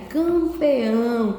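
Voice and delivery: CAMPEÃO very slow, every syllable stretched; dictation. Shown as a demonstration. Teacher dictates the word for pupils to write, slow stretched delivery. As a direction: draw out campeão with long nasal vowels. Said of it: "você vai escrever aí".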